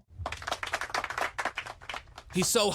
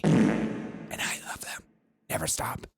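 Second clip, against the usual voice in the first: whisper; 6 semitones lower, slower than usual. Pretend. Mock adoring aside to host.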